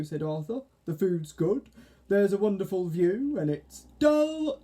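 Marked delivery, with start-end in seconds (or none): 0.8-3.9 caterpillar voice. Acted. Arthur character voice. 4.0-4.6 high, loud, stressed "Dull"; emphatic. Acted. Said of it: "said Mavis emphatically".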